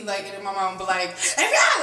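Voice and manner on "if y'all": mom scolding. Retold or imitated. Mom scolding kids, quoted.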